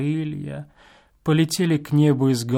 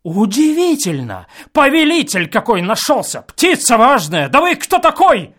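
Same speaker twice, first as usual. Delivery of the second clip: loud, higher indignant cry; indignant shout; 9 semitones higher, 8 dB louder than usual. A narrator's reading. Guest shouts back at the old man, indignant. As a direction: scoff at him furiously. Angry quarrel through wall.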